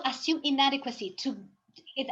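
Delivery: ordinary delivery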